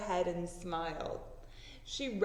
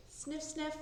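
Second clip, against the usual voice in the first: sniffing sound; 7 semitones higher, 6 dB quieter than usual. Acted. Dog sniffing in story.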